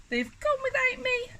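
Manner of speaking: high, small forlorn voice; crying out. A narrator's reading. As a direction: lament being left behind in a tiny high voice. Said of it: "And he cried".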